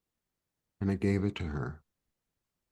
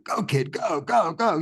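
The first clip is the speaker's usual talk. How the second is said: put-on cheering the kid on; 8 semitones higher than usual. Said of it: "And I'm like".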